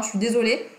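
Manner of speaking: normal talking voice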